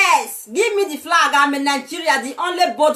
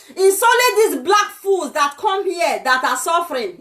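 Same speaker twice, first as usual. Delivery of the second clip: usual speaking voice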